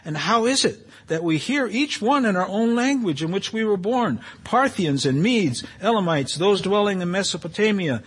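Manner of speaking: animated Bible reading, voicing the amazed crowd; lively scripture reading that rises on the crowd's words. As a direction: read the verses to the congregation with wonder. Reads Acts passage aloud.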